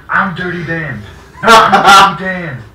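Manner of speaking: rising from firm to shouting while laughing; building insistent shout. Acted. Prank caller insisting, building.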